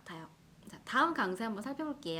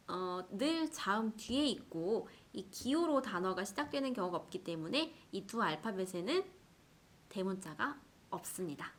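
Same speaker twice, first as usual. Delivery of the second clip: usual speaking voice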